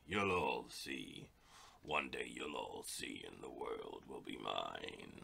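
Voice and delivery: slow, deep evil villain voice; mad scientist. Acted. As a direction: gloat like a cartoon villain. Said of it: "Enjoy my silly, evil, bad guy voice".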